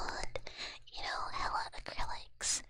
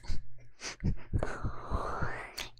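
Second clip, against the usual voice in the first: whispered laugh; 3 dB louder than usual. Real, not acted.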